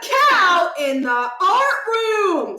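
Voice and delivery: loud, high, slow excited shout; shouted alarm. Acted. Kid yelling through the window about cows, loud and slow. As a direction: blurt it out to everyone.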